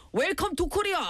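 put-on gangster's English, high-pitched Korean-accented cry; Toksu. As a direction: imitate a movie gangster greeting rivals in accented English. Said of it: "but he speaks English".

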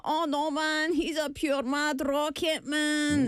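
put-on high, drawn-out Glaswegian ned accent; Glasgow ned. Accent lover showing how a ned talks, Glasgow ned accent. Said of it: "so they'd be like".

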